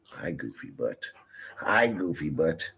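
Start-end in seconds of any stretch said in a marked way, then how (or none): 0.1-1.0 soft unvoiced whisper to the dog. Real, not acted. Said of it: "talking to dog, whispered".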